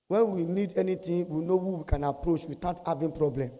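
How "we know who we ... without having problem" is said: ordinary delivery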